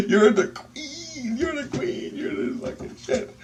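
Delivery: very high squealing praise; awestruck gushing. Retold or imitated. Teller praises lady of light, high excited squeal. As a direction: stretch it into a hammed-up adoring squeal.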